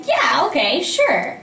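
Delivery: high-pitched, eager-to-please yes; sweetly agreeable. Retold or imitated. Director mimicking the actor who always says yes, sweetly agreeable. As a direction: imitate an over-nice pushover agreeing. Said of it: "'Cause you're sweet, and you're always like".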